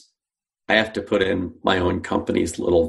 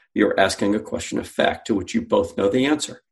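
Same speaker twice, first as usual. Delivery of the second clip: ordinary talk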